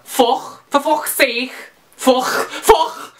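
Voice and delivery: thick mock-Scouse with throaty k sounds; exaggerated Scouse accent. Shown as a demonstration. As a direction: do an over-the-top Scouser. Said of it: "I can try and do Scouser".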